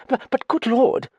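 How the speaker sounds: high-pitched, flustered stammer; crying out. A narrator's reading. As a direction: cry out in high-voiced protest. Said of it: "cried Dallings".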